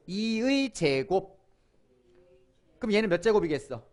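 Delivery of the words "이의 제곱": very slow, drawn out for repeating; slow model reading. Shown as a demonstration. Teacher modelling 이의 제곱 for the class, very slowly. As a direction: say 이의 제곱 slowly and evenly. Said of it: "따라해봐".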